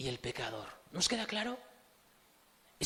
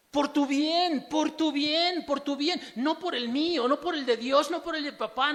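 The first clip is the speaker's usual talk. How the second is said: high, loud urgent cry; an alarm shouting at the listener to beware; shouted warning; 10 semitones higher, 6 dB louder than usual. Acted. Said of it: "gritándote y diciéndote".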